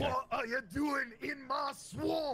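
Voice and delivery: loud, slow Scottish ogre shout; Shrek. Acted. Friend showing off a thick accent, Shrek voice. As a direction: bellow it out in a drawn-out Scottish brogue. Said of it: "they had to do the Shrek voice".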